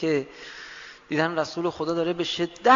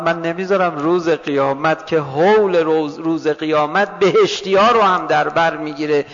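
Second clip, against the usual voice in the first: impassioned preaching; 4 semitones higher, 7 dB louder than usual. Acted. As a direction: preach God's promise at full force, rising steadily. Preaching God's promise, building.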